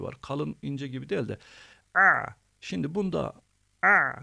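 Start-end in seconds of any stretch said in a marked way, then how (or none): 1.9-2.3 "a" squeezed from the throat, high and loud; throaty Arabic ayn; pressed ayn. Shown as a demonstration. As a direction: strain the throat for a tight "a". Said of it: "a demiyoruz ki".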